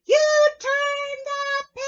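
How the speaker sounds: high, loud, slow Grover scream. Acted. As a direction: wail it out in outrage. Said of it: "He's screaming it out".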